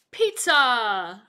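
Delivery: loud reveal. Shown as a demonstration. Teacher revealing the answer to the class, loud. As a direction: burst out the punchline word loud. Reveal after suspense pause.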